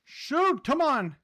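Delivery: loud, high frustrated shout; frustrated outburst. Real, not acted. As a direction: yell at the game in frustration. Frustrated mid-game outburst.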